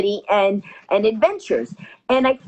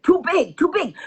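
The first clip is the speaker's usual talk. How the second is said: put-on higher, exasperated Russian accent; 8 semitones higher than usual. Russian pilot refusing the whole-ship photo, raised accented voice. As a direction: imitate a Russian pilot protesting, pitch jumping up. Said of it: "He goes".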